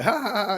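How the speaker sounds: high, loud mock laughing; imitated laugh. Logan trying to clean up the moment, imitated laughing it off. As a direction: put on a big high-pitched fake laugh. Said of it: "he, like, laughed over".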